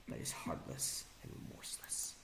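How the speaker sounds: hushed, breathy whisper. Real, not acted. Whispering a final insult at a rival. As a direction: breathe the insult out almost silently. Quiet insult at end of rant.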